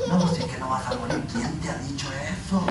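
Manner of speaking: low, hushed, persuasive murmur; imitating the devil's gentle whispery temptation; soft tempting devil. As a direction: coax very softly, sowing doubt. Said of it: "el diablo le habló suavecito y le dijo".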